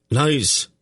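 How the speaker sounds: high, mild narrated scream; high-pitched outcry of denial. A narrator's reading. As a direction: cry it out high, without full volume. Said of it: "he screamed at them".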